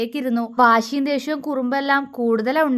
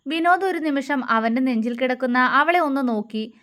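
plain reading voice

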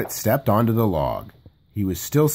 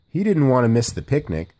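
usual speaking voice

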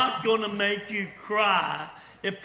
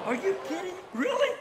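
much higher, disbelieving yelp; incredulous; 11 semitones higher than usual. Acted. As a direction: shoot up in pitch in disbelief. Walt reacts to Mary's complaint.

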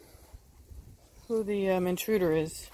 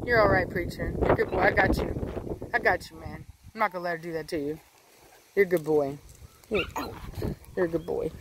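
softer, lower comforting; soothing the dog; 4 semitones lower, 3 dB quieter, faster than usual. Real, not acted. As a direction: reassure the scared puppy gently.

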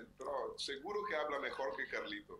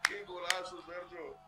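excited praise; 8 semitones higher, slower than usual. Real, not acted. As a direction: hail the scorer with joy. Praising a friend's great goal.

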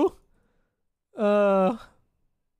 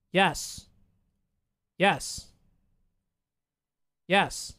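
ordinary talk

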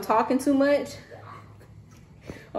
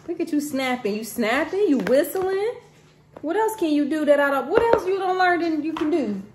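high, amazed parent voice; excited praise; 7 semitones higher, 3 dB louder than usual. Real, not acted. Parent admires child who has learned to snap, excited praise.

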